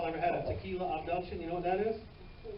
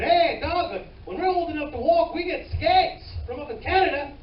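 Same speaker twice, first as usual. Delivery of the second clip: loud, higher-pitched fake Canadian accent; botched Canadian accent, pitched up and loud; muddled Canadian accent; 9 semitones higher, 7 dB louder than usual. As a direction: imitate a Canadian, badly and loudly. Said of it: "I don't know what fucking accent I'm doing".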